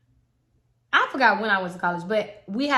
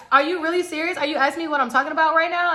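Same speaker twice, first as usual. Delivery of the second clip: mock upset boyfriend; 7 semitones higher, 4 dB louder, faster than usual.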